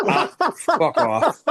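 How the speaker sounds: slow, high mocking brush-off; drawn out, high, scornful fuck off; mock dismissive. Mocking a wrestler with a silly gimmick name, high and slow.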